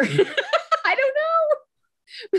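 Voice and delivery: high drawn-out laugh through words. Retold or imitated. Laughing retold reply.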